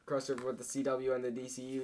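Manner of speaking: ordinary talk, nothing marked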